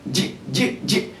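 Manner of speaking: J whispered short with no vowel; touch J and let it go, no vowel; bare consonant J. Shown as a demonstration. Teacher showing the class a bare J sound. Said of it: "So you say J".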